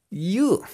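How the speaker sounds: YOU like tasting bitter coffee; disgusted you. Shown as a demonstration. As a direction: say YOU as if grossed out by your own coffee. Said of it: "si probaran el café que ustedes mismos hicieron y les quedó amargo".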